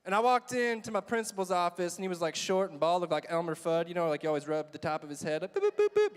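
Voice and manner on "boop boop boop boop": high, loud little boops; playful boops. Retold or imitated. Student mimics rubbing principal's bald head, playful boops. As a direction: boop it high like tapping a head.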